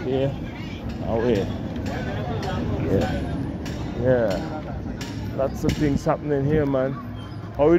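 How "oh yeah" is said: normal talking voice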